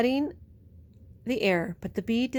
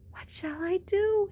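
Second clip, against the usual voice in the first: whispering rabbit; 10 semitones higher than usual. Acted. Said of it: "he whispered to Squirrel".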